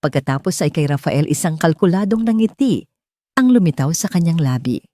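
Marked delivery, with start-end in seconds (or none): none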